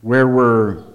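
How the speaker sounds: "Where were you" snapped in mild irritation; a touch of annoyed impatience; irritated. Shown as a demonstration. Pastor playing a friend kept waiting an hour.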